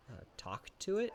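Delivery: ordinary delivery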